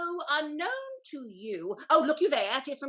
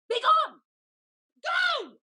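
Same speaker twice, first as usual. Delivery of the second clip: higher, louder enraged command; furious shout; 11 semitones higher, 6 dB louder, slower than usual. Acted. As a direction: explode at her. Lady dismissing her servant.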